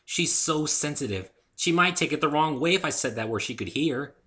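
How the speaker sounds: protective Pinkie. A narrator's reading. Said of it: "Pinkie speaking".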